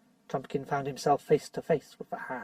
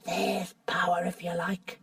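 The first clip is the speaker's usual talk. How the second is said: hushed, unvoiced whisper; a covetous whisper about power; 2 semitones higher, slower than usual. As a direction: breathe it like a secret. Nickabrick savouring the witch's power.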